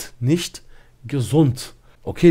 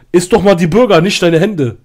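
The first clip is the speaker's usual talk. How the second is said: louder mock-angry brother's jab; retold angry jab; 4 semitones higher, 6 dB louder than usual. Brother scolds his sister over burgers, mildly angry retold jab. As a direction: snap it like an irritated brother at the table. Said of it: "er war sauer so".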